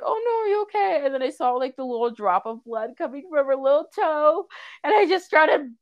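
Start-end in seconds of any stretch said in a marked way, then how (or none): 0.0-1.1 high-pitched mock alarm; high, concerned retold cry; retold worried fussing. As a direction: fuss over a hurt pet in a high voice. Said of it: "And then I was like".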